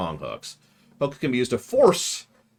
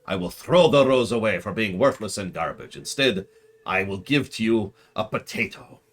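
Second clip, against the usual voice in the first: put-on theatrical suitor in a muddled, not-quite-Irish accent; unplaceable mock accent. King wooing his wife-to-be, hammy muddled accent. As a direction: declaim love grandly in an unidentifiable mock accent. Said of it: "I do not know what this accent is".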